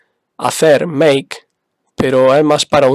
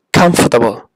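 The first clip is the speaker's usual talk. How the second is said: "comfortable" with a strong COM and falling end; teacher's model word, punch "com" in "comfortable"; stress on first syllable; 3 semitones lower than usual. Shown as a demonstration. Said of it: "en este caso va en la primera".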